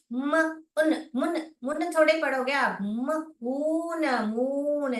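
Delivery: मून blended slowly from म and ऊन; sounding out moon. Shown as a demonstration. Teacher to kids, blending मून sound by sound. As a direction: sound out म then ऊन, join into मून. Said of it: "ऊ आ रहा है".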